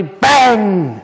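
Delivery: high, loud shout of "bang". Shown as a demonstration. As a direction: burst out with a sudden bang. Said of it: "Was that loud?".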